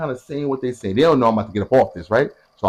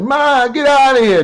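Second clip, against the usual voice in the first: much higher, louder and slower, shooing her mother out; daughter shooing mom; 13 semitones higher, 6 dB louder, slower than usual. Retold or imitated. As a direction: chase mom out of the room in a high voice. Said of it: "Mom, she's like".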